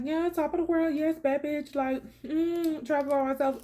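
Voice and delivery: cocky self-hype. Retold or imitated. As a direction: brag it like you're on top of the world. Quoting her own confident mood.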